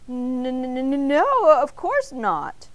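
stuttered start bursting into a loud no; nervous, stammering denial; flustered stammer. A narrator's reading. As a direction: trip over the first word, caught out. Flustered man stutters his denial.

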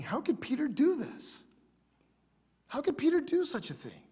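high, swooping disbelief; acted bewilderment, voice leaping up; incredulous questioning.

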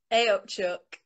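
mock Yorkshire "hey up, Chuck", "up" as "oop"; a playful put-on Northern hello; mock Northern greeting. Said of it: "she hates Northerners".

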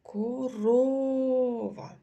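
Корова very slow, the second syllable РО held long and loud; stress on коРОва. Shown as a demonstration. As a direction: drag out Корова syllable by syllable, lean on РО. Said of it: "який склад наголошений".

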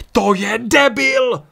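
high, loud, drawn-out outburst; devastated shout. A narrator's reading. Ryan reacts to the priest's murder, shouting in devastation. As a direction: yell it out in shattered disbelief. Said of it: "vykřikl zdrcený Ryan".

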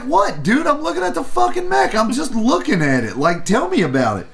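very high-pitched indignant disbelief, acted out; retold incredulous protest. Reviewer retelling his protest to booth guy, incredulous. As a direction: protest in a high voice, easing down at the end.

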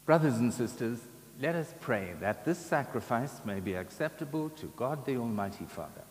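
ordinary talk, nothing marked

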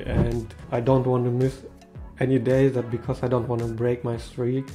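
ordinary talk

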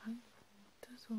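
hushed, half-whispered comfort to a crying friend. Acted. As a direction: soothe them gently, close to the mic.

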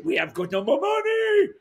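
put-on high French accent; squeaky French manager saying it. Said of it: "And that's how he spoke".

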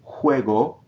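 Juego with ue glided together; one syllable. Shown as a demonstration. Teacher models Juego, ue glided together. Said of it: "single syllable".

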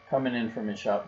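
plain reading voice

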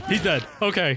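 ordinary delivery

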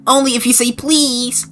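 high, whiny cartoon voice; pestered game character. Stuck character telling Kel to stop messing around, high whine. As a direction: complain in a high put-on voice.

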